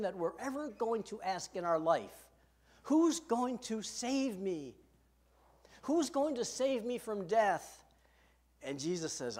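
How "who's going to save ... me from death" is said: slow pleading question. Acted.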